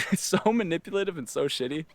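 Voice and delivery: amused cringe at yourself; laughing in disbelief. Real, not acted. Storyteller looking back on his own shitty move, laughing.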